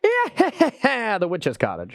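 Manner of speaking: put-on witchy cackle, starting high and falling; witch laugh. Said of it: "That's my best witch laugh".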